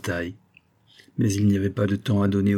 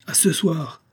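called out; 7 semitones higher, slower than usual. Acted. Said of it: "cria Gaston de Pompéran".

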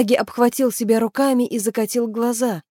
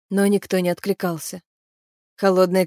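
usual speaking voice

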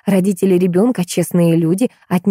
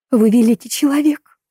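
high, overcome with awe; barely able to speak; 5 semitones higher than usual. A narrator's reading. Martha awed by the note's recipient, struggling to speak. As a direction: force the words out, overwhelmed, in a raised voice. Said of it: "с трудом вымолвила Марта".

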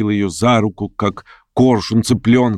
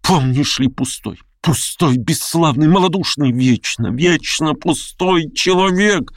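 высокий сдавленный гневный шёпот; шипи упрёки, удерживаясь от крика; яростное шипение; 9 semitones higher, slower than usual. A narrator's reading. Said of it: "шипела она своим яростным шёпотом". (яростным шёпотом, сдерживая крик)

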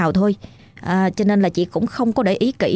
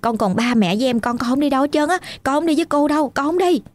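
put-on high-pitched retelling of a child's feverish protests; child raving in sleep, voiced higher at normal volume; delirious child crying out; 5 semitones higher than usual. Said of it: "Nó la quá trời luôn".

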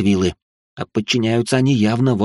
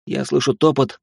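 usual speaking voice

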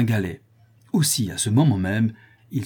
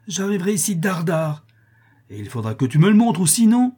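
high, mounting warning; stern ultimatum in a raised voice; angry threat; 8 semitones higher, 4 dB louder than usual. A narrator's reading. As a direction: threaten him, building to a loud peak. Elder threatens trainer.

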